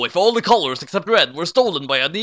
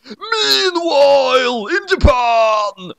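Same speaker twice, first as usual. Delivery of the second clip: loud, slow trailer voice, higher; dramatic announcer; 10 semitones higher, 5 dB louder, much slower than usual. A narrator's reading. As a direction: announce the scene change like a movie trailer. Dramatic scene change announcement.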